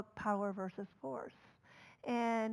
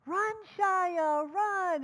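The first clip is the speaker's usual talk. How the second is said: high, eager mock shout; imitating a crowd yelling run; retold cheering; 7 semitones higher, 3 dB louder, much slower than usual. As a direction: cheer him on from the bench. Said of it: "they all screamed".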